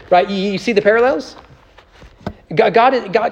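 ordinary talk, nothing marked

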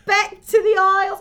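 high, loud hammed-up British accent; mock British accent. Joking about the Isles, a hammed-up British accent. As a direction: boom it like a stage Brit. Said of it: "We nailed that accent".

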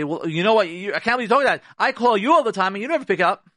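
a wife snapping back, hurt and indignant; mock angry wife. Wife scolding her husband, in a mock indignant retort.

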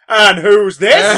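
slow, loud laughing dad challenge; gruff overprotective dad. Retold or imitated. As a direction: play Chewie as a suspicious dad, half laughing. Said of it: "Chewie being like".

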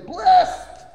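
Blessed cried out high and very loud; shouted blessing. Shown as a demonstration. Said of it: "you gotta say it just like that".

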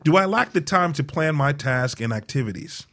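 ordinary talk, nothing marked

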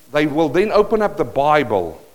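ordinary talk, nothing marked

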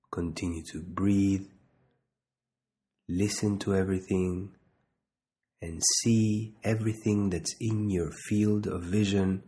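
slow, measured meditation guide with long pauses; unhurried body-scan instruction, leaving space to breathe; guided meditation. Real, not acted.

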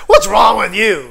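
loud, exasperated mock rebuke; mock scolding. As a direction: shout it as an acted telling-off. Imagined God scolding the disappointed.

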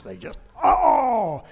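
exasperated groan. Acted. Groaning at long sermons.

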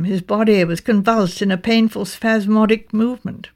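plain reading voice

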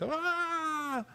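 high, drawn-out "aah" held back in volume; mock child's scream. Child running to father screaming, mock wail. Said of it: "всё кричит".

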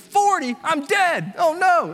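high-pitched comic panic; mock alarm. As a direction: retell it as a shocked, overdramatic yelp. Retells panic at misread temperature.

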